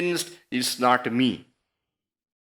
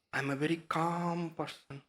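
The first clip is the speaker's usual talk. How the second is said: much softer, hushed self-description; soft calm claim; 12 dB quieter than usual. Acted. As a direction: voice a calm person, very softly. Quotes a calm person's self-description.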